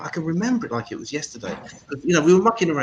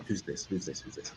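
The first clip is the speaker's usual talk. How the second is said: softer and softer, trailing off; fading teaser; 8 semitones lower, 14 dB quieter than usual. Shown as a demonstration. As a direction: let it fade to nothing. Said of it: "This is my impersonation".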